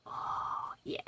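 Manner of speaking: soft whisper to the cat. Real, not acted. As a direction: hush it like a secret.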